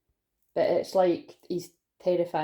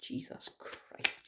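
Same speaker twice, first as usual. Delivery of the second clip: exasperated whisper; 14 dB quieter, much slower than usual. Real, not acted. Laughing at her own words.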